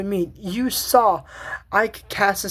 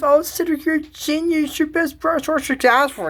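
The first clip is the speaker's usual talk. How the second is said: higher, bright admiring voice; a light higher mock Cordelia voice; gushing Cordelia; 7 semitones higher than usual. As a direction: gush over him like a fan. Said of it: "And she goes".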